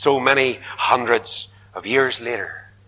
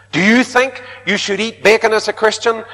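eager questioner; 8 semitones higher, 3 dB louder than usual. Retold or imitated. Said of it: "they said to him".

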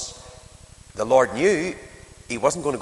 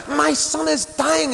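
desperate plea; 12 semitones higher than usual. Acted. Preacher voicing the frantic father, high desperate plea. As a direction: beg for your dying son.

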